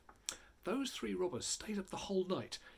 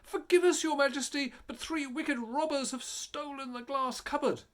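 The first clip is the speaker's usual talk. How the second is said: much higher, louder, begging; pleading servants; 10 semitones higher, 5 dB louder than usual. A narrator's reading. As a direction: beg the king for mercy.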